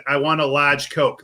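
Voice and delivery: slow order, "large" as "lahge"; resigned repeat with Boston "large"; New England "large". Retold or imitated. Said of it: "how much of an accent you have".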